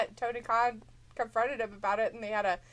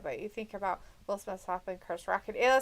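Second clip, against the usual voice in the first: ordinary talk, nothing marked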